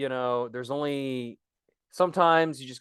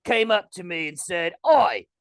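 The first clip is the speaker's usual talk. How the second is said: hammed-up, muddled British accent; 3 semitones higher than usual. Guest recounting the Pokemon Company's request, mock British accent. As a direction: tell the anecdote in bad fake Cockney. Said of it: "British or Australian or what are you".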